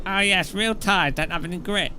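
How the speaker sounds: mock the sim racers' smug claim; hint of a smug forum expert. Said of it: "they're like".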